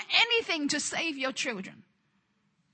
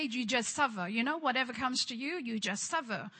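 lower, softer, resigned mock sermon; mock defeatist preaching; 4 semitones lower, 6 dB quieter than usual. Preacher mocking the religious spirit's message, softer and lower. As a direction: mimic a false teaching, softly. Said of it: "the religious spirit twisted it".

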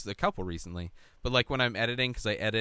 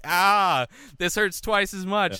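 mock pained groan; 10 semitones higher, 7 dB louder, slower than usual. Imagined self-cringe reaction.

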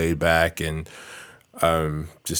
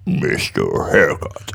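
low, slow, breathy whisper; 9 semitones lower than usual. Pretend. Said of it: "I don't really have a good range of voices".

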